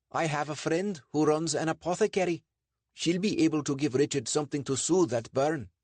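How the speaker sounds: high-pitched, helpful character voice; Sandeep, helpful. A narrator's reading. Sandeep offering an apothecary friend's help, high helpful voice.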